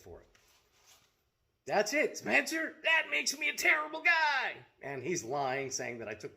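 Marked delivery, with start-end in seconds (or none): 1.7-4.5 much higher and louder mocking yell at Spencer; real loud sarcastic outburst; sarcastic shout. Real, not acted. Calling out Spencer directly.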